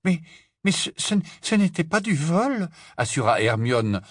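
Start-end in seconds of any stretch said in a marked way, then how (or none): 0.0-2.7 high, stammering protest; distraught. A narrator's reading. Hermione defending herself to her friends, distraught. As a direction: stumble over a flustered denial. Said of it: "d'un air éperdu".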